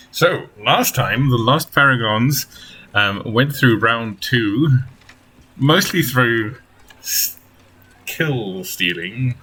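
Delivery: slow storytelling recap; story narration. Acted. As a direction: recap the last session like a storyteller. Game master recap narration.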